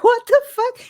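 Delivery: high-pitched mock bewilderment; baffled reaction. Host retelling her reaction to Clara's creepy remark, mock bewilderment. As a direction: voice jumps way up, baffled.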